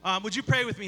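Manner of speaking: ordinary talk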